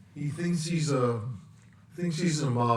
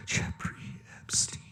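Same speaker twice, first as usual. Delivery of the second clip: distant whisper; 6 dB quieter than usual. Shown as a demonstration. Repeating name in varied voices.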